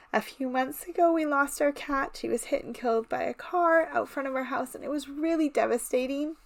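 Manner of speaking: high, tight voice close to tears; emotional. Real, not acted. Card maker telling viewers about losing the family cat, emotional. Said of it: "I'm sorry I'm emotional".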